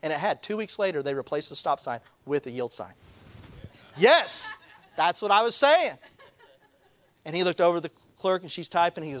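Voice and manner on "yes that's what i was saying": high, jubilant mock vindication; triumphant outburst. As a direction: burst out as if proved right. Retold triumph at being proved right.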